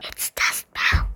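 whispered secret into the mic; breathy whisper close to the microphone; right in your ear. Real, not acted. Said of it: "I'm going to whisper it into the microphone".

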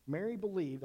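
normal talking voice

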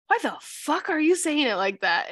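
acted-out exasperated friend; mock exasperation. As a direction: demand it in playful disbelief.

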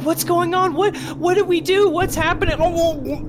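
put-on very high, panicked children's voices; frightened kids. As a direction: squeal questions like scared children. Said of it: "the kids are, like".

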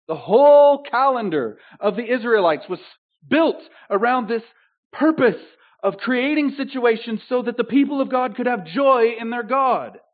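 high, loud exhortation; fervent preaching. Acted. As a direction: preach it out to the congregation. Preaching at full force.

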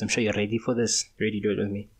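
ordinary talk, nothing marked

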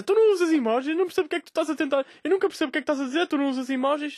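high, fast mock complaining voice; complaining girl. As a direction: rattle off her whiny complaint.

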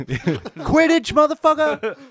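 loud, high gleeful yell; shouting. Retold or imitated. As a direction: yell it out like a kid on a broom. Yelling as flying kids.

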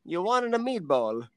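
mock Italian waiter. Waiter scolding the Irish customer, hammed-up scoffing Italian waiter. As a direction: play the waiter sneering at the customer's order. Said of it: "And he'd be like".

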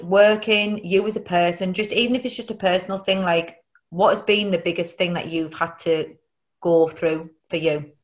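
ordinary talk, nothing marked

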